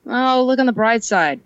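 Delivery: usual speaking voice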